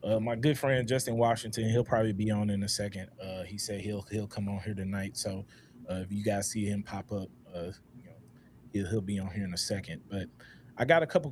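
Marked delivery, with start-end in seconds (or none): none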